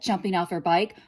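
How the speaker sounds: ordinary delivery, nothing marked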